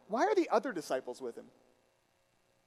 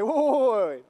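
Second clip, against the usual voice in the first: higher, loud burst of protest; urgent, booming "hold on"; loud interruption; 3 semitones higher, 9 dB louder than usual. Acted. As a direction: cut in loudly to halt the story. Imagined audience interrupting the story.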